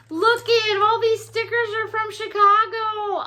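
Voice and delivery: high, excited gushing; thrilled. Real, not acted. Showing off the Chicago stickers, thrilled. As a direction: gush in a high, delighted voice.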